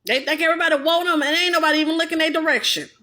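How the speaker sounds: usual speaking voice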